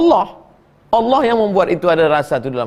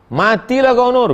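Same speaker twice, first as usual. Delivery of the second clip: high, loud mock threat to the daughter; mock exasperated mother; 8 semitones higher, 4 dB louder than usual. Mother fed up that Nur keeps ignoring her, mock angry call. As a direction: scold her half in jest, high and loud.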